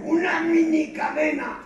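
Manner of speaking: high, slow words through clenched teeth; mock clenched-jaw speech. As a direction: talk without opening your jaw. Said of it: "¿Por qué hablas así?".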